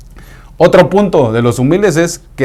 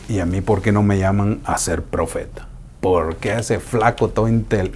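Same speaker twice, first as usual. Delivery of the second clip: softer, lower grumbling of a jealous believer; mock envious complaint; 4 semitones lower, 8 dB quieter than usual. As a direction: whine like someone passed over. Envious believer voiced in sermon.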